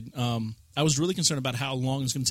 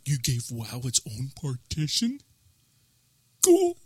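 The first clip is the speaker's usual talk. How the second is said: Sniper; slower than usual. Retold or imitated. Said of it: "That's what Sniper's like".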